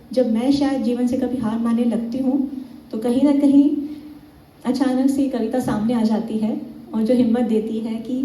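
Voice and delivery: ordinary talk, nothing marked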